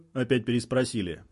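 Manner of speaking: usual speaking voice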